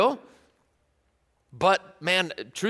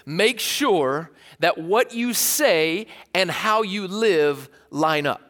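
slow, deliberate dad advice; much slower than usual. Retold or imitated. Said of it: "James would kind of say it like this".

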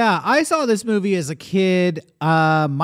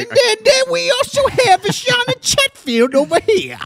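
very high hammed-up host voice; showman voice; 4 dB louder than usual. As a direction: introduce him like a circus ringmaster. Host intro in put-on voice.

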